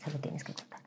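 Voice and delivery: hushed firm counsel; quiet pastoral urging; whispered advice. Retold or imitated. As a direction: whisper the instruction. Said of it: "pastor's quoted advice".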